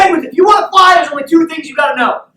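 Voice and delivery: high, full-volume pep-talk shout; fired-up coach. Acted. Coach teaching penguins the two things, fired-up shout. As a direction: drill the lesson into them at a yell.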